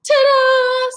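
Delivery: high, loud ta-da reveal; excited ta-da. A narrator's reading. Annie proudly shows Jack the book, bright ta-da. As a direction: announce it like a proud magician.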